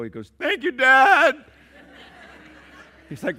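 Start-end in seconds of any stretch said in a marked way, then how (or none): 0.4-1.4 high, slow, tearful voice of the grateful son; choked-up thanks. Retold or imitated. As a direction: thank dad with a catch in the throat. Son calling dad, overwhelmed.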